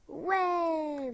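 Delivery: high, drawn-out yay; mock cheer.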